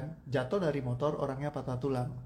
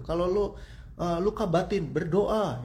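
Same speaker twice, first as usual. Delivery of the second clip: higher and louder, berdoa pushed out like an order; preachy loud advice; 5 semitones higher, 4 dB louder than usual. Retold or imitated. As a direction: imitate people loudly telling you to just pray. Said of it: "Kan banyak yang bilang gitu". (nasihat keras yang ditirukan, lebih tinggi dan lantang)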